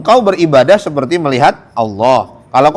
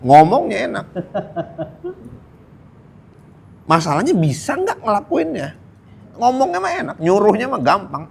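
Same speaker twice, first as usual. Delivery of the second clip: sarcastic; 7 semitones higher than usual. Acted. Sarcastic aside on easy talk.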